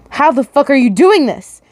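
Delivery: a lightly voiced angry man hissing through his teeth; hissed. Attacker hissing at the dreamer who blocks him, a hint of menace. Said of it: "he hisses".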